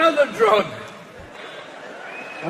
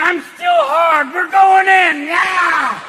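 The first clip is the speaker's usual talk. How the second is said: loud, higher, slow pumped-up yell; cocky penis hype; 6 semitones higher, 7 dB louder, slower than usual. Acted. Penis cheering on the old man, pumped yell. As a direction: hype yourself up like a young stud. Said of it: "the penis is going".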